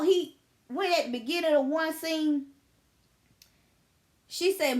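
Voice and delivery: ordinary talk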